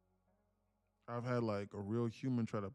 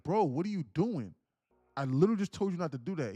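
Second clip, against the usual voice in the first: exasperated scolding; 7 semitones higher, 10 dB louder than usual. Retold or imitated. Streamer retells scolding the user.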